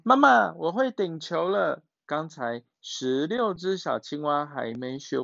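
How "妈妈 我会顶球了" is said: proud little panda. Acted. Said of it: "小熊猫说".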